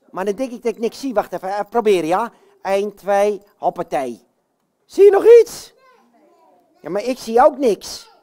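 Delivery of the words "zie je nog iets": high, loud shouted question; roepend naar publiek. Pretend. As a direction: call it out to the whole audience. Roept vraag naar publiek. (hoog en luid roepend)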